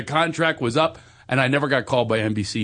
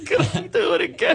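higher, meek little question; pathetic plea; 5 semitones higher, faster than usual. Retold or imitated. Failed actor asks the casting room for another try, mock pathetic plea. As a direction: plead feebly, hamming it up. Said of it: "I'm standing just pathetic".

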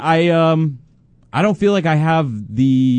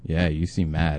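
much softer and lower, almost under the breath; sheepish and quiet; 7 semitones lower, 8 dB quieter, slower than usual. Real, not acted. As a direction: mumble it cautiously to the angry boss. Repeating it to an angry boss.

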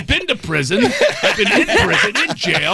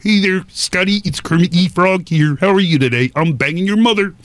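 Kermit voice. Retold or imitated. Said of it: "He loves me 'cause I do voices".

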